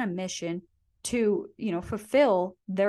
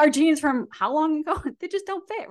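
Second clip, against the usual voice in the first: laughing; 8 semitones higher, 4 dB louder than usual. Real, not acted. Laughing about old jeans.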